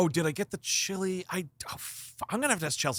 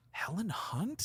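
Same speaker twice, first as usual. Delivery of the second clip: whisper; 5 semitones higher, 9 dB quieter, slower than usual. Retold or imitated. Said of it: "literally whispered to myself".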